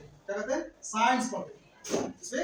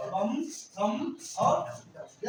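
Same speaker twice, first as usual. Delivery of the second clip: words drawn out slowly for the class; slow teaching read-out; 2 semitones higher, much slower than usual. Shown as a demonstration. Teacher to class, slow model reading. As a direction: spell each word out slowly for pupils. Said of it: "बोलो, दम".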